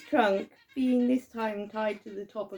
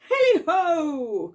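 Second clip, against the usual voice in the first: long, loud, high holler; jovial cry; 6 semitones higher, 7 dB louder, slower than usual. A narrator's reading. Old Fezziwig skipping down from the desk, jovial holler. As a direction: holler it out, drawn long and falling. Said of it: "cried old Fezziwig".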